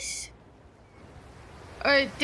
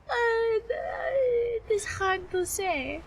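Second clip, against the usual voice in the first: mock French accent, pitched up; hesitant, higher-pitched fake French; horrible French accent; 7 semitones higher than usual. Streamer dodging the question of a timer, higher mock French accent. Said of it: "It wouldn't be French".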